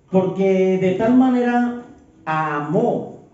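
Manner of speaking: weighty pulpit reading, each word given room; scripture reading. Acted. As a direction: proclaim the verse to the congregation. Reads John 3:16 aloud.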